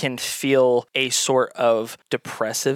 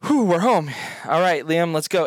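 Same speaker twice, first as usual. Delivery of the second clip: lightly voiced relieved mother; a hint of a tired mom arriving home; Charlotte's voice; 5 semitones higher than usual. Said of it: "Charlotte goes".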